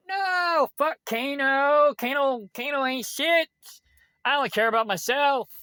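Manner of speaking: high, sneering impression of the freeloader; mocking imitation. Mocking the roommate's attitude.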